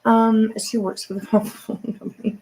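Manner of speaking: normal talking voice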